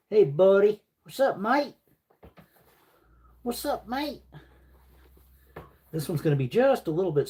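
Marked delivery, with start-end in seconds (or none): 0.1-1.7 high, playful Australian "What's up, mate?"; mock Australian accent. Mock Aussie greeting to viewer. 3.4-4.2 softer, high Australian "What's up, mate?" said half to himself; quiet mock Australian. Repeats greeting, quieter.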